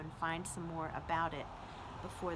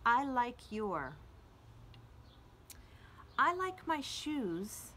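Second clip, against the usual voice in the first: louder, slow and lilting poem voice, savoring each line; poem reading; 4 dB louder than usual. Acted. Librarian reading a poem to children at home, louder and lilting. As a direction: read it like a gentle love poem to a child, dwelling on the repeats. Reads E E Cummings tribute poem.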